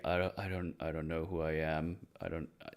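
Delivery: soft, low, halting imitation of confusion; lost, faltering murmur with restarts; stammering. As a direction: stammer it quietly, trailing away. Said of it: "just kinda sitting there stammering".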